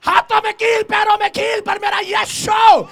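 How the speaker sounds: loud, high shouting sermon; preaching at full force. Acted. Preacher shouting to the church about Jesus, full-force sermon. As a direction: cry it out to the congregation.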